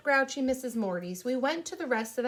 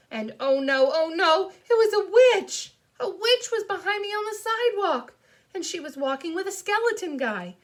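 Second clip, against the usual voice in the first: loud, high, rising panic; alarmed storytelling; 9 semitones higher, 7 dB louder than usual. A narrator's reading. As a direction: cry out in fright at a witch. Narrator alarmed at seeing a witch.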